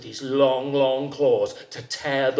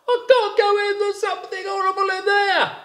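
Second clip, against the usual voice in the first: high frightened rabbit; startled rabbit; 3 dB louder than usual. A narrator's reading. Rabbit answers jackal.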